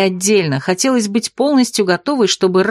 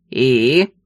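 low prodding monster; 7 semitones lower than usual. A narrator's reading. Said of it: "вновь подтолкнул кширянец".

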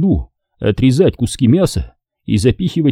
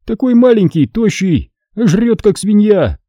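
a raised voice mocking a greedy comrade; Hasan pressing on; 10 semitones higher than usual. A narrator's reading. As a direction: keep jabbing with contempt. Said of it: "продолжал напирать Хасан".

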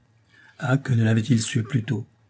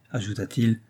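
plain reading voice

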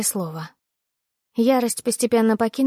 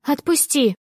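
sharp higher cry while pulling free; struggling against restraints; 6 semitones higher, slower than usual. Acted. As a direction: yank free and shout. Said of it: "Я попыталась вырваться из его хватки".

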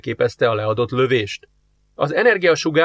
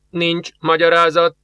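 slow, higher flat machine reply; indifferent computer; 5 semitones higher than usual. Acted. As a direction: answer like an unmoved computer. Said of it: "jelentette a géphang". (közönyös géphang)

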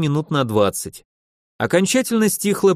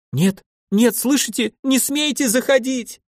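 high panicked cry of a lady; 10 semitones higher than usual. A narrator's reading. Lady forbids the man to come into her cell, panicked cry. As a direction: stop him entering in sheer panic. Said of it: "был полон паники голос дамы".

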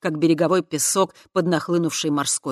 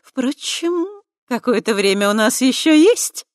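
higher, louder, vindictive smirk; vengeful smirk; 8 semitones higher, 8 dB louder than usual. A narrator's reading. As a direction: sneer it with a vengeful grin. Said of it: "мстительно усмехнулась".

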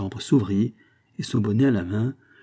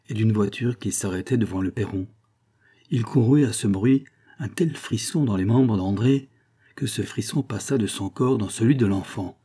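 ordinary delivery, nothing marked

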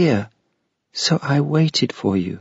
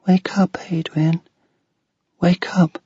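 high, breathless ghostly whisper in the ear; soft whisper; 9 semitones higher than usual. Acted. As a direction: whisper gently into his ear to wake him. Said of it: "The girl's voice spoke softly".

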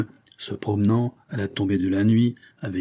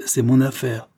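plain reading voice

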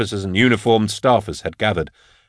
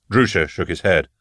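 ordinary delivery, nothing marked

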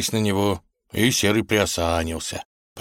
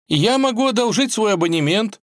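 very high-pitched, keen voice; eager offer; 4 dB louder than usual. A narrator's reading. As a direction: jump in readily to help. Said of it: "с готовностью предложил".

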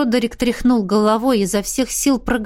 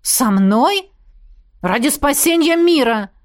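higher, startled exclamation; exclaiming; 6 semitones higher, 3 dB louder than usual. A narrator's reading. Izergast protests for the world's sake, exclaiming. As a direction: cry it out with a lifted voice. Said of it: "воскликнул Изергаст".

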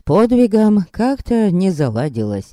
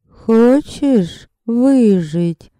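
high, very slow, drawn-out female voice; otherworldly woman's voice; 7 semitones higher, much slower than usual. A narrator's reading. Unseen woman offers a dying man survival, otherworldly female voice. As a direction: draw each word out high, like an eerie temptress. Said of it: "спросил женский голос".

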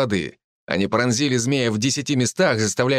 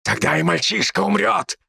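high shrieking cry; shrieking spirit; 7 semitones higher than usual. Acted. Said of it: "выкрикнул". (визгливый крик злого духа)

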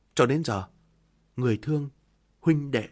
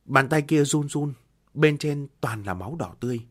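usual speaking voice

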